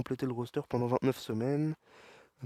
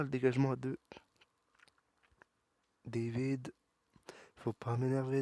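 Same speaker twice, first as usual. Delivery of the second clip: ordinary delivery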